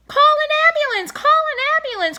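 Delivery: very high, loud shrieking cry; screaming lady. A narrator's reading. Lady cries over the fighting, loud high shriek. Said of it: "cried the lady".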